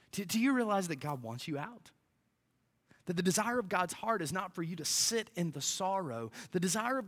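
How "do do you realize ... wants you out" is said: normal talking voice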